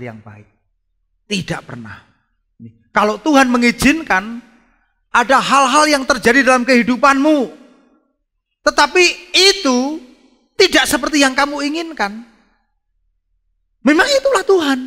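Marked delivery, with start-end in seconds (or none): 2.9-14.8 high, loud sermon proclamation; full-force preaching. Real, not acted. (berkhotbah lantang berapi-api)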